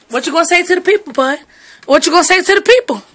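rapid mock scolding; fast scolding. Real, not acted. Owner fast-scolding the dog. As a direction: rattle it off at the dog.

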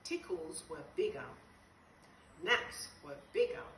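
usual speaking voice

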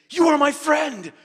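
high, loud heartfelt shout; emotional declaration. Retold or imitated. Kim the minder at the airport, shouting his friendship. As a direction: burst out with it like a hug. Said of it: "He said".

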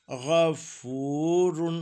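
गफूरुन with a long, held "fooo"; slow recitation, गफूरुन with prolonged middle vowel; full long vāv. Shown as a demonstration. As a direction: stretch the ū of गफूरुन slowly before "run". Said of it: "पूरे वाव की आवाज़ बनेगी".

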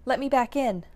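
a mock-panicked cat demanding back in; loud demand. Cat changes its mind.

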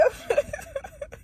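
high, breaking laugh-sob; tearful laugh-cry. Real, not acted. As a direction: crumble into high tearful giggles. Breaks down crying after speaking.

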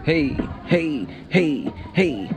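normal talking voice

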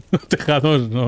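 slow, laughing through the words. Real, not acted. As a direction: chuckle the words out slowly. Repeats phrase, laughing.

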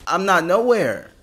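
loud, high panicked fleeing; yelling while running for your life; being chased. Real, not acted. Fleeing the giant woman in-game.

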